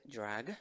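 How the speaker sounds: "drag" with an audible g at the end; final G pronounced. Shown as a demonstration. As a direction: sound out the last G of "drag". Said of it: "La G al final también se pronuncia". ("drag" pronunciando la g final)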